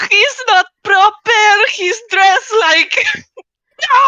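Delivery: high, loud, laughing dismay; laughing outrage. Real, not acted. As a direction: cry out in delighted horror. Outraged mock dismay at news.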